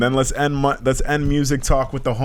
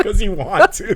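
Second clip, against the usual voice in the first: giggling through the words; laughing at own joke; 9 semitones higher than usual. Real, not acted.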